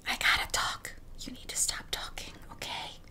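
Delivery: hushed whisper to the cat. Real, not acted. Whispering to her cat to stop meowing, hushed whisper. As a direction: whisper it so the recording isn't disturbed. Hushing the cat while recording.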